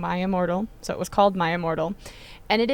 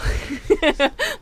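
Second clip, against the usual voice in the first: laugh; 9 semitones higher than usual. Real, not acted. Laughing about weird fanfic.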